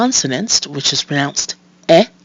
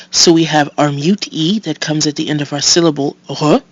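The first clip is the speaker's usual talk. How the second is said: ordinary delivery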